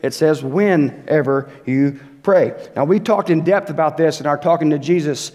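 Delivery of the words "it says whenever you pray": slow, leaning on "whenever"; stress on whenever. Shown as a demonstration. Pastor pointing the church to the verse's wording, slow stress on "whenever". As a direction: draw out "whenever" to set it against "if". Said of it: "notice it doesn't say if you pray".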